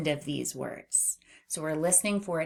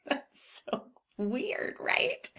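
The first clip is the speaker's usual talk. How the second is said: amused; 7 semitones higher, 5 dB quieter, much slower than usual. Real, not acted. Amused after tongue exercise.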